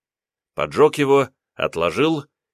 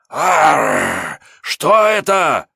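high, slow snarling yelp; panicked demon cry; 6 semitones higher, 6 dB louder, much slower than usual. Acted. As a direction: yelp in pain and fear. Demon hits barrier again. (испуганный демонический крик)

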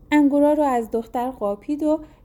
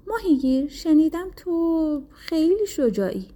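king's voice; 5 semitones higher than usual. A narrator's reading. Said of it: "پادشاه گفت".

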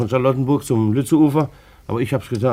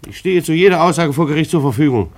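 loud, firm vow before the court; emphatic declaration; 4 semitones higher, 7 dB louder than usual. Acted. Glienicke vowing to testify in court, emphatic. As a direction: stand by every word.

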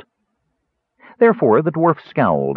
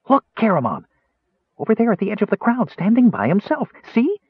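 high, quick and eager; excited kender; 12 semitones higher than usual. Acted. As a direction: point it out breathlessly fast. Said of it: "the Kender said in excitement".

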